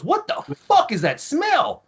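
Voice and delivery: high, drawn-out incredulous cry; slow, squeaky-high mock outrage; acted appalled outburst. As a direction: imitate an officer recoiling at a stench. Disgusted officer's outburst, retold.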